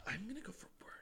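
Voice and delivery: conspiratorial whisper; hushed joke. Pretend. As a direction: whisper it like a secret.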